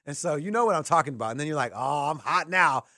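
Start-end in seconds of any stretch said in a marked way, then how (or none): 0.1-1.3 lightly voiced cocky gambler, a bit louder; a hint of a boastful roulette player; mock gambler. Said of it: "you sound like the guy that hit two". 1.7-2.8 cocky gambler. Retold or imitated. Mocking gambler on a streak.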